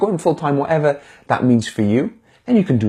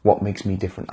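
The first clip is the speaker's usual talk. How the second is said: hushed, worried self-doubt; softer murmur of self-doubt; doubting inner voice; 4 semitones lower, 8 dB quieter than usual. Retold or imitated. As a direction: mutter it like a nagging voice in your head. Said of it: "that voice in your head thinking".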